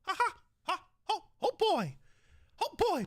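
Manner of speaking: high squeaky mouse laugh and cry; Mickey Mouse impression. Streamer opening a Mickey meets Trump bit, squeaky Mickey. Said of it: "Mickey meets Trump".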